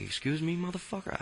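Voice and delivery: very high, incredulous retort; mock-affronted, pitch shooting up; indignant challenge. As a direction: act out taking sharp offense, rising in disbelief. Acted affront at principal.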